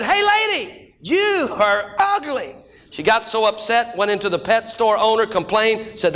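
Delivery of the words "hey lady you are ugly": high parrot squawk, slowing on the insult. Acted. Parrot insulting the woman again, high and slow. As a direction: squawk it, then drag out the insult. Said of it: "and he said".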